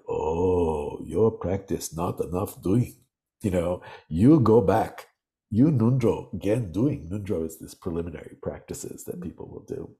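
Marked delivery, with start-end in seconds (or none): 0.1-7.1 put-on gently scolding in a light Tibetan accent; a teacher chiding his student in broken English, Tibetan accent; heavy Tibetan accent. The Rinpoche chides the translator about her practice, light Tibetan accent. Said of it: "his English had such a heavy accent".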